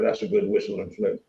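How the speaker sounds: understated Cockney slang line; Cockney accent. Retold or imitated. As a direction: drop into mock Cockney for the suit compliment. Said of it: "That's Cockney for a suit".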